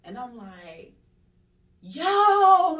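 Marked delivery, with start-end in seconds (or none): 1.8-2.8 huge high-pitched holler; retold yell. Frozen dreamer at alien-looking thing, acted-out loud yell. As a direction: belt it out as a drawn-out cry for help. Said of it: "I'm trying to yell".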